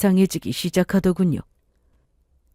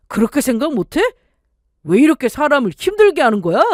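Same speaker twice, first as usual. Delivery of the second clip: husband's growing complaint; 2 semitones higher than usual. Acted. Stressed husband justifying his temper, building to an outburst. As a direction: let the frustration climb to the end.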